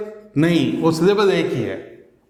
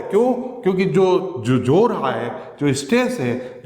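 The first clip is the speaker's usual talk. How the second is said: normal talking voice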